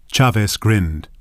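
usual speaking voice

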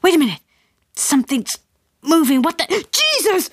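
dad on the ledge seeing something move; alarmed. Acted. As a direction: build from wary to a startled yelp. Dad spots something on roof.

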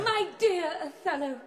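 high-pitched stage greeting; Desdemona greeting Othello. Acted. Actress answers her Othello in rehearsal, declaimed high. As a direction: lift it high, without pushing the volume.

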